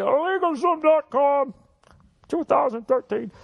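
put-on slow, high old man croak. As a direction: answer like a proud old man. Old man answers kid.

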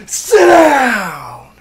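loud, high "sit down"; triumphant shout. Real, not acted. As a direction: bark it out at the strikeout. Striking out batter.

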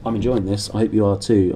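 plain reading voice

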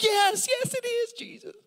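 very high, loud whiny Jonah; hammed-up Jonah tantrum; whining Jonah. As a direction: wail like a sulking child. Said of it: "He's like".

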